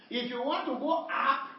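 ordinary talk, nothing marked

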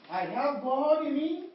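ordinary talk, nothing marked

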